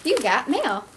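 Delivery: AOL mail alert. Retold or imitated. Vlogger announcing the next package, mock AOL alert. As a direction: imitate the old AOL announcement, bouncing in pitch. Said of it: "Didn't A O L used to say that?".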